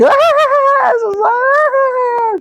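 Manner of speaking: long, very high fake wail; exaggerated imitation of bawling; mock crying. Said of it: "she starts crying".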